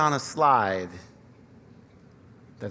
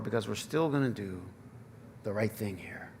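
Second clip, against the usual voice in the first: hushed; 6 semitones lower, 11 dB quieter than usual. Acted. Preacher drops to hush.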